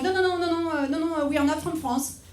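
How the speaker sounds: put-on high, hurried denials in thick French accent; exaggerated French accent. French tourists hiding their nationality in America, exaggerated French accent. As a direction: deny it in a hammed-up French accent. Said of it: "pretend to be from somewhere else".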